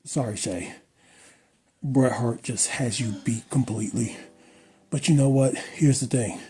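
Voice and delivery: hammed-up gushing ad read for a fellow YouTuber; mock sponsor ad read. Vlogger thanks a fellow creator in a mock sponsor read, gushing. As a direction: sell it like a cheesy fake sponsorship.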